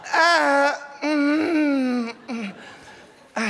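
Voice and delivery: hammed-up moaning; man finishing too fast; mock climax moans.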